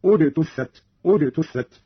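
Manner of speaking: اجتثت begun on a short u, ujtuththat; starting with damma. Shown as a demonstration. Teacher shows starting after a pause, اجتثت as ujtuththat. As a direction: open اجتثت with a hamza plus u. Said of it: "كان البدء بضم الهمز هكذا".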